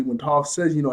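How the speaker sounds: ordinary talk, nothing marked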